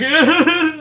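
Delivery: high embarrassed giggle; nervous laugh. Real, not acted. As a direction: laugh it off, put on the spot. Laughs when put on spot.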